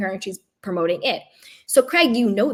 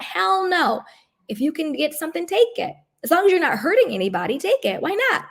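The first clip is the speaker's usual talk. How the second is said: excited; 7 semitones higher than usual. Real, not acted. Excited urging, big pitch.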